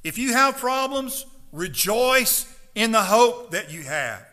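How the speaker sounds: loud, fiery preaching to the congregation; full-force preaching. Acted. Pastor urging his congregation not to whine, fiery loud preaching. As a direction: pound the message home from the pulpit.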